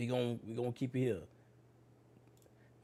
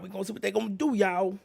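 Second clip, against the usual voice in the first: excited; 9 semitones higher, 4 dB louder than usual. Real, not acted. Hyped repeat, louder.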